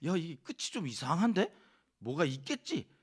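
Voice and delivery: puzzled reader. Retold or imitated. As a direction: sound like something must be missing. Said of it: "계속해서".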